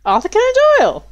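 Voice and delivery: high, loud and fast cry of recognition; excited shout. Real, not acted. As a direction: blurt the name out in delight.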